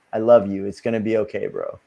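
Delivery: low retold comfort, softening to the end; reassuring. Calming a scared stranger after his threat, reassuring. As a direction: soothe him low, fading away softly.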